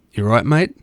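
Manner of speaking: casual Australian "You right, mate?", lightly voiced; easygoing Aussie greeting, a touch brighter in accent. Aussie host answering a staring DJ in Australian accent. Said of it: "in a pure Aussie accent".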